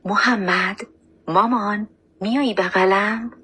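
slightly weak, pleading lift in pitch; choked weak voice. A narrator's reading. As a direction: call to your child in a faint, caught voice. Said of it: "با صدایی گرفته و ضعیف".

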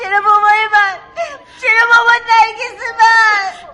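loud, slow tearful cry; crying lament. Acted. Grieving girl crying out why it was her father, loud and slow. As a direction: wail at the injustice.

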